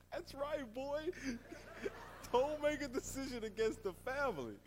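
hint of a Godfather don warning a young man; Godfather mobster impression. Pastor imitates a Godfather-style boss scolding the team member, lightly voiced mobster. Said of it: "he watches The Godfather every year".

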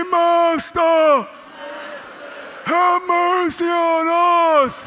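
loud, high, sustained call; lifted-up voices. Shown as a demonstration. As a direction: lead the crowd in a long cry. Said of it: "they lifted up their voices".

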